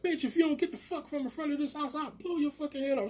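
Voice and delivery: high-pitched imitation of an irate woman; mock angry threat. Wife threatening the neighbour at gunpoint, mock angry.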